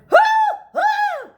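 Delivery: loud high hooting; owl hooting. Acted. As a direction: holler like an outraged owl. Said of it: "who hollered".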